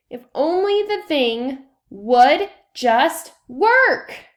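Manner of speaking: slow, loud, strained wish through clenched frustration; frustrated plea. A narrator's reading. The girl, fed up with her failing project, pleads in loud strained frustration.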